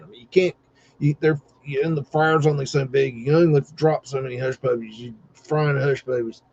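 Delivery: usual speaking voice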